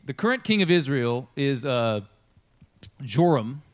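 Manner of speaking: usual speaking voice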